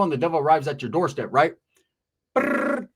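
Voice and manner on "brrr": high trilled "brrr" like a ringing phone; imitated phone ring. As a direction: trill a ringtone with the mouth. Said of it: "phone rings".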